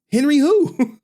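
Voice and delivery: mock confused shout. Retold jail chat, mock confusion.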